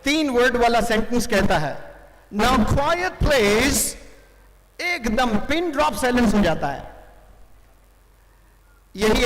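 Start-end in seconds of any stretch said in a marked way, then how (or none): 2.3-3.9 slow, drawn-out commentator's final call for silence; changed tone, firmer. Retold or imitated. Commentator to the last mischief-makers, slow insistent hushing call. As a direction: stretch each word as an insistent announcement. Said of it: "अब वो फिर tone change करता है".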